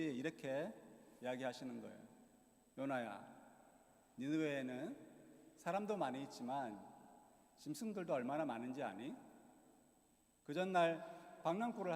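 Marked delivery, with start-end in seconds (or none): 2.8-9.1 gentle admonishing. Retold or imitated. God talking to sulking Jonah like a grandchild, gentle admonishing. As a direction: coax gently in a slightly lowered, unhurried voice. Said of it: "부드럽게 정말 말 안 듣는 손주 타이르듯이".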